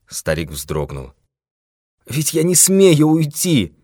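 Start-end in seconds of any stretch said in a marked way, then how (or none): 2.1-3.7 high, slow, tearful plea; nearly crying. A narrator's reading. Old man too frightened to leave the office, nearly crying. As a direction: wail it out, on the edge of tears. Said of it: "чуть не заплакал он".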